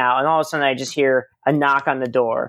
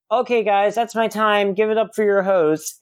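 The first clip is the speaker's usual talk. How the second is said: raised-pitch imitation of a stand-up closing; stage comic sign-off; 7 semitones higher than usual. Comic on stage hands back to the host, imitated high sign-off. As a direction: call it out like wrapping a set.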